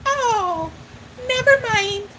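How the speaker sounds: put-on high little old lady, backing down; imitating a flustered old lady, high; Gilda Radner's old lady. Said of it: "and she'd go".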